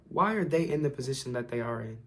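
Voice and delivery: normal talking voice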